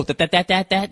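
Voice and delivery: stuttering on that, like a cartoon pig; Porky Pig stammer. Comic replaying his own stutter to the audience, Porky Pig stammer. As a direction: trip over each "that" like Porky Pig. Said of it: "I sound like fucking Porky Pig".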